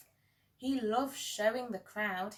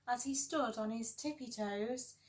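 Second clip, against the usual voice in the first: usual speaking voice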